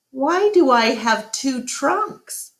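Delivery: a lightly voiced little elephant, baffled; puzzled child. A narrator's reading. Ruby asks Ivan about her drawing, lightly puzzled.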